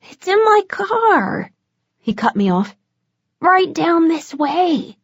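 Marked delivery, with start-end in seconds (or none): 0.1-1.5 much higher, slow, sing-song lure; giggly vampire dealer. A narrator's reading. Vampire coaxing her toward his car, high slow sing-song. 3.4-5.0 much higher, slow, sing-song lure; giggly vampire dealer. A narrator's reading. Vampire coaxing her toward his car, high slow sing-song.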